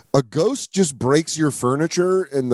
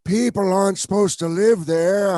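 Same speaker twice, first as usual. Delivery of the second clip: mock spooky old man, a bit higher; hammed-up haunted-house warning; old neighborhood man warning; 6 semitones higher than usual. Friend imitating the creepy old neighbor's warning, mock spooky. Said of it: "Warren's the old man in the neighborhood".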